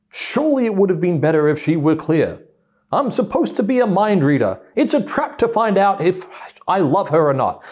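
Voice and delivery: husband's inner complaint about mind reading, loud and high; exasperated husband's thinking. Retold or imitated. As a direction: ham up the put-upon husband. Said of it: "so why didn't she?".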